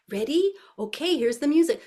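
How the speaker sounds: high, eager cue to toddlers; rally the little dancers brightly; animated teacher voice. Shown as a demonstration. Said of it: "So I'm in and out".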